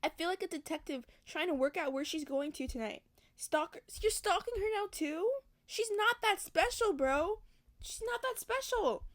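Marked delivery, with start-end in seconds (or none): 3.3-9.0 high incredulous scoff at the hero; mocking outrage. Real, not acted. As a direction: squeal it in disbelief.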